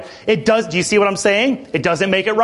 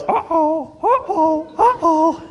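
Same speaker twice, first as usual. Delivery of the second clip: high, laughing sing-song uh-oh; singsong warning; 11 semitones higher, slower than usual. Retold or imitated. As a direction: warn playfully with a laugh, much higher. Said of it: "So then Dad goes".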